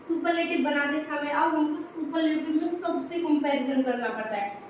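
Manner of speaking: ordinary talk, nothing marked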